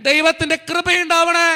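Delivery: high, loud, ringing preacher's cry; rising revival sermon, voice lifted high and loud; fiery preaching. Acted. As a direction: preach it out to the whole hall at full force. Full-force preaching climax.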